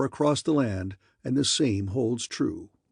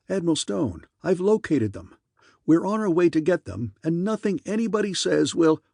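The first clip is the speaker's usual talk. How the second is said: higher-pitched, insistent protest; heated defiance; 6 semitones higher, 3 dB louder than usual. A narrator's reading. Wilson arguing with the Admiral by phone, insistent. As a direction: push back urgently at a superior.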